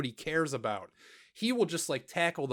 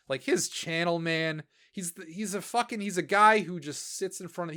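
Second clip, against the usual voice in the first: usual speaking voice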